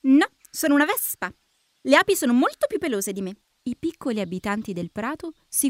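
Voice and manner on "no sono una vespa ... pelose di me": quick, lively wasp voice, pitched up; perky insect retort; wasp character. Acted. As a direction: correct them briskly as a little wasp.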